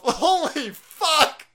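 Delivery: slow, laughing holy fuck; laughing disbelief. Real, not acted. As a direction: laugh it out slowly. Laughing at the quote.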